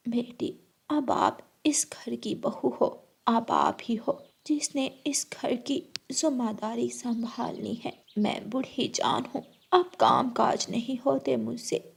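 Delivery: put-on hushed elderly voice; whispering old mother-in-law. Mother-in-law handing duties to new bride, hushed. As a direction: speak as a tired old woman.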